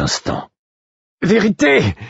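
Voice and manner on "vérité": sudden high desperate cry; a cry that jumps high and breaks out of the narration; cried out. A narrator's reading. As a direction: call out the name with all you have left. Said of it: "criai-je".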